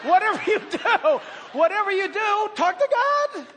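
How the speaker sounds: high, loud, punchy preacher's cry; loud exhortation. Real, not acted. Preacher urging congregation to talk to God, loud exhortation. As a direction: exhort them at full voice, pitched right up.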